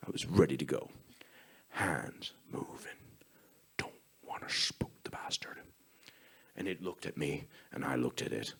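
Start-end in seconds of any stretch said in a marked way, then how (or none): 1.7-5.6 whispered, holding very still; tense stalking whisper; hushed tension. Retold or imitated. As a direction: breathe it so the deer won't bolt. Tense standoff, not to spook deer.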